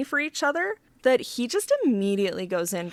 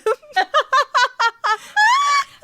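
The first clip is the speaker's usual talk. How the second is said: big laugh; 9 dB louder than usual. Real, not acted.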